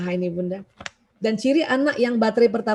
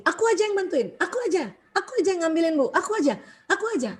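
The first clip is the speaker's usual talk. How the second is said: put-on higher, eager little-kid voice, rushing at the end; eager child; 6 semitones higher, 3 dB quieter, faster than usual. Eager child begging mum to let him help, voiced high. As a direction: jump in like a keen child offering help.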